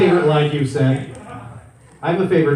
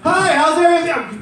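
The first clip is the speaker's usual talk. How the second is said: mock shout; 13 semitones higher, 6 dB louder than usual. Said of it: "Why are you guys screaming?".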